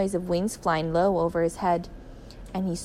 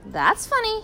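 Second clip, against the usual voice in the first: puzzled; 13 semitones higher than usual. A narrator's reading. Puzzled man finds hand stuck.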